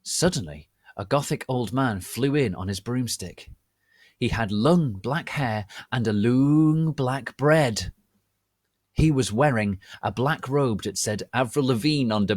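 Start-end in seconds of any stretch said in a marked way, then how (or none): none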